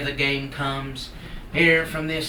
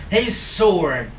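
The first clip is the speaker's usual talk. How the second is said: ordinary delivery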